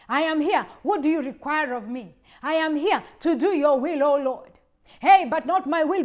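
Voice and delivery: loud, earnest prayer offering himself; fervent surrendered prayer. Acted. Preacher voicing a believer surrendering to God, loud. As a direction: cry out to the Lord in surrender.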